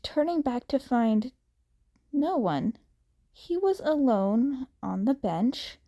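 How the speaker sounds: usual speaking voice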